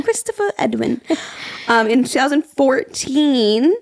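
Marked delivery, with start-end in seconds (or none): 0.0-1.0 lightly put-on British accent. Showing co-host how the name sounds, in a British accent. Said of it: "so British".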